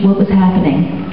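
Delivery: ordinary delivery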